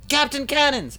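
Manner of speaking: very high, loud squawked exclamation; Mo, comic stooge. Acted. Third stooge capping the echo, high comic squawk. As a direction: top the others with an absurdly high cry. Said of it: "Mo looks just like".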